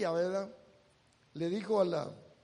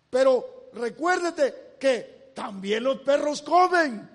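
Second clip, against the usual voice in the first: triumphant retort; 3 semitones higher, 6 dB louder than usual. Acted. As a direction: fire back the winning answer. Réplica de la mujer, predicada. (réplica triunfante en voz alta)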